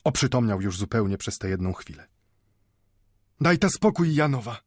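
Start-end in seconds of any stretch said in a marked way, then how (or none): 3.4-4.6 put-on raised, firm old man's voice; hard, ringing voice. A narrator's reading. Old man turns away the women's help, hard ringing voice. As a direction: refuse firmly, ringing like a bell. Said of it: "mówił twardym, brzmiącym jak dzwon głosem".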